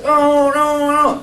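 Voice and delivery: high whining child voice, a touch of fake crying; whiny child. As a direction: whine like a small boy who won't eat. Voiced child protesting the order. (anak merengek menolak makan)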